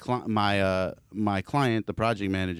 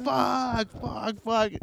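imitated pain; 11 semitones higher, 5 dB quieter, much slower than usual. Injured man doused with water.

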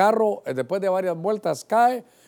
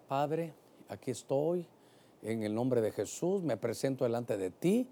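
very soft, low, measured praying; subdued formal prayer; 6 semitones lower, 11 dB quieter than usual. Acted. Preacher modelling a composed prayer before God, subdued. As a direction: pray quietly and low, with reverence. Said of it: "hay oraciones donde uno puede gritar".

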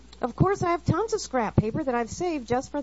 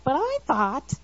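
louder and higher, indignant "But I thought"; raised protesting voice; 5 semitones higher, 5 dB louder than usual. Retold or imitated. Wife protesting to her husband in a raised, higher voice. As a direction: push back sharply at the husband. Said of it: "I raise my voice and my tone".

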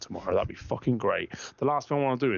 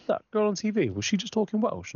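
high, mock-innocent wife; high-pitched mock wife; 13 semitones higher than usual. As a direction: squeak it out like the wife asking. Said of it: "And she goes".

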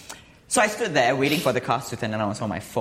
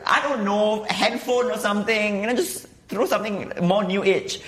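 high mocking voice, tongue in cheek; sarcastic mocking; 10 semitones higher than usual. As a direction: scoff at them in a high voice. Mocking the stone throwers.

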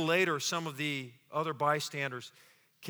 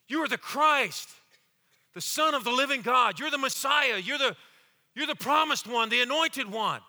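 higher, emphatic declaration of faith; bold confession; 6 semitones higher, 3 dB louder than usual. Acted. Peter confessing faith to Jesus, bold and emphatic. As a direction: declare it with rising conviction.

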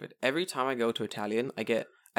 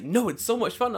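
retold enthusiasm; 9 semitones higher than usual. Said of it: "He's having the time of his life".

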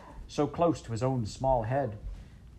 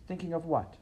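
princess voice; 8 semitones higher than usual. Acted. Princess asks.

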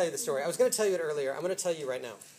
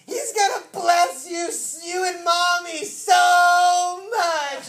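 high, loud, drawn-out ecstatic shout; imitated hysterical child; 11 semitones higher, 15 dB louder, much slower than usual. Little Isaac telling Zach about the blessing, imitated hysterical excitement. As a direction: cry it out like an overjoyed little kid. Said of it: "hysterically laughing".

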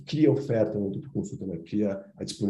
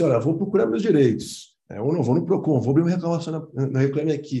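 higher, louder mock complaint; raised-pitch retold customer protest; imitated complaining patient; 5 semitones higher, 4 dB louder than usual. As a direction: act out a patient threatening to complain.

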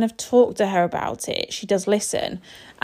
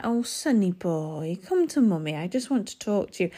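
calm, almost sad; 2 semitones lower, 3 dB quieter than usual. Retold or imitated. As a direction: coax a toddler over, gentle and a little sorrowful. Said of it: "really calm, like, almost sad tone".

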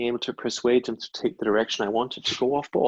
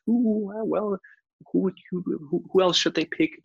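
musing friends; 8 semitones higher than usual. Retold or imitated. Friends' hopeful musing, acted out.

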